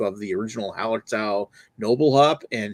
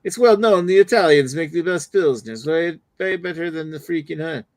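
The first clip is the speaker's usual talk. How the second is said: mock Italian mobster; 4 semitones higher, 4 dB louder than usual. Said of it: "both sound like Super Mario".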